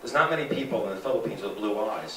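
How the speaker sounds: ordinary talk, nothing marked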